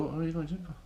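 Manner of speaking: a relative quizzing you about the gym, lightly voiced; questioning relative. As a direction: imitate a baffled family member. Family member questioning the gym.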